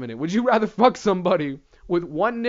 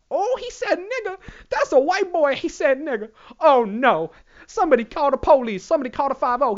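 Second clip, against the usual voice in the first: high-pitched mock alarm, easing off gradually; scandalised onlooker; 6 semitones higher than usual. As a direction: raise a hammed-up alarm like a tattletale. Acts scandalised onlooker tattling.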